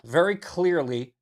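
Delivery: ordinary talk, nothing marked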